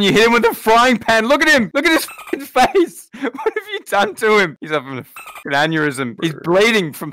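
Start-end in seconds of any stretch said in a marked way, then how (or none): 0.0-4.6 high, loud shocked yelling; horrified yelling. Acted. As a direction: shout in disbelief at the damage. Horrified at frying pan injury.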